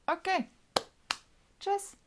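very high, short sing-song words; falsely breezy high goodbye; mock-cheerful sign-off. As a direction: pitch each word way up, with a pause between. Retold curt exit while angry.

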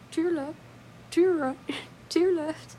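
high, lilting dance calls with a giggle; playful dance caller. Pretend. Imagining them doing the Irish polka, calling steps playfully.

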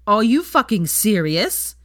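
loud, high, slow disbelief; outraged. A narrator's reading. Ron confronting Hermione about Draco, outraged and loud. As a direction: hurl the disbelief at her.

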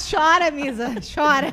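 cheering the host on to cry; playful egging on. Real, not acted. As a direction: tease her to let it out. Teasing the crying host.

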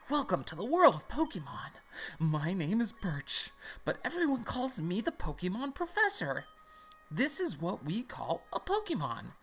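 high, swooping professor voice; professor character. Acted. Pokemon professor welcoming a new player, put-on high voice. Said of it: "I need a voice for this guy".

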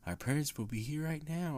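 soft, mock sing-song kids; sing-song children. Children at the door saying parents are coming, in sing-song. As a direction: lilt it softly like eerie children. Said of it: "if they all sound like in, in a singy, songy".

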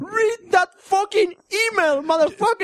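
high, slow hammed-up tough talk; high mock gangster voice; mock rapper. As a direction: boss the host like a cartoon rapper.

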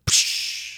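short voiceless "pssh"; imitated glass blast. Game master as windows blow out, hissed crash noise. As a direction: burst of hissed air for shattering glass. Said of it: "all the glass blows out".

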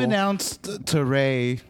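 slow, drawn-out storyteller intro; mock-epic opening line; slow dramatic. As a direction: savor each word like a documentary narrator. Dramatic repeated story opener.

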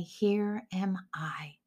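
soft whisper, slow and reverent; whispered slowly to God in the night; whispered answer. Acted. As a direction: breathe it like a humble reply to God. Eli teaches the answer.

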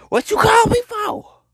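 high, indignant squeal; high-pitched disbelief at a late call; incredulous outcry. Real, not acted. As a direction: demand to know why they called.

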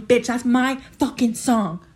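loud, slow, punched-out enthusiasm; emphatic fangirling. Real, not acted. Fan raving about her top song Maroon, emphatic fangirling. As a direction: claim it proudly, hitting each word.

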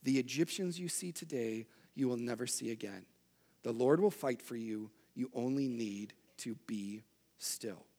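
calm the frightened crowd gently; calm reassurance. Retold or imitated. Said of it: "Moses stands up and says".

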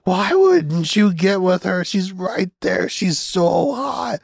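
put-on high, slow, strained whine of a boy; frustrated teen whine. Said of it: "put your face in a pillow and start screaming".

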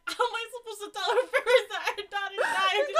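high, loud quote breaking into laughter; laughing through a hammed-up worried wail; mock panic. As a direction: imitate a panicking friend while cracking up. Retelling friend's panic, laughing.

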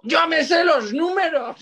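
hammed-up triumphant exclamation; excited cry. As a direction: imitate a teammate proudly bursting out. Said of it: "Y de repente Alex dijo".

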